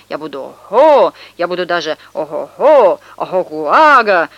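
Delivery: swelling boast. Acted. Boy bragging about who he will grow up to be, boastful. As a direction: boast with booming relish.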